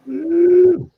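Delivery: high, loud whoop; a celebratory "woo" to close the night; cheer. Real, not acted. Cheer at end of stream.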